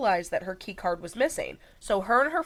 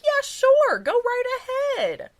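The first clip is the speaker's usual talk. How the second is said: high, sing-song mock eagerness; chirpy retold consent; breezy agreement; 6 dB louder than usual. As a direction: imitate an all-too-willing yes, pitched way up. Acts out suspect's breezy agreement.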